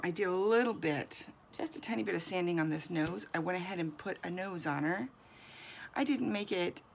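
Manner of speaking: ordinary delivery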